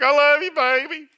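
high-pitched mock female holler; hammed-up lovesick holler; wife hollering back. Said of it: "she's hollering back".

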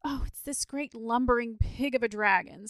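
high, loud tearful complaint; sobbing. A narrator's reading. Boy complaining to Saint George about the dragon, sobbing. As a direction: wail it like a sobbing child. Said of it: "sobbed the boy".

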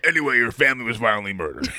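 fast, offhand announcement; laughing mock nonchalance; breezy grim news. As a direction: rattle it off carelessly.

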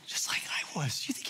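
a sudden breathy whisper, leaning in. Real, not acted. Preacher whispering to the congregation about a father leaning forward. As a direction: drop to a secret whisper for the crowd.

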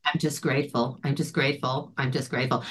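soft low murmur of thanks; quietly imitating his relief; grateful. Warren's first words after finding the car, soft and low. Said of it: "the very first thing you said is".

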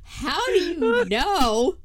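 shrieking with shocked laughter; shocked question. Retold or imitated. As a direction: gasp it out in disbelief. Shocked mother asking son.

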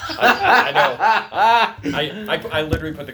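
high and loud, laughing through the words. Real, not acted. Cohost laughing through his own joke. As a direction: crack up mid-joke.

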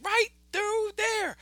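very high, slow cartoon rabbit; Brer Rabbit. Rabbit directing the others to the hole, slow high voice. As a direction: point it out in a drawn-out high squeak. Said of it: "He's like".

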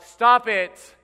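sharp, loud parental yell; yelled command. Acted. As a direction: yell it out to make a child quit. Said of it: "a cry that you yell out".

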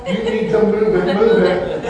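a light mock party lemur chant; lemur from Madagascar. Preacher quoting the Madagascar lemur to urge the church, lightly sung. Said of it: "in the words of the lemur from Madagascar".

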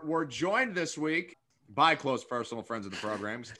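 talking around a gummy bear; chewing while talking. Real, not acted. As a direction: mouth full of candy. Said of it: "I'm chewing on a gummy bear".

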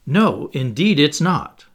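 NO and NOT slow and loud; slow, loud, emphatic "No, indeed"; frightful emphasis. A narrator's reading. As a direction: weigh down each word of "indeed it's not". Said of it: "replied with frightful emphasis".

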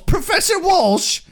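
high, slow indignant British outburst; mock outraged Giles. Quoting Giles insulting Professor Walsh, high mock indignation. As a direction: sputter the insult like a scandalized librarian. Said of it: "that sounds really vulgar".

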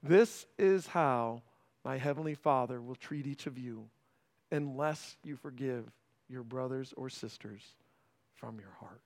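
very soft, low closing verse; scripture reading. Acted. Pastor closing the parable for the church, very soft. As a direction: let the last verse fade to almost nothing.

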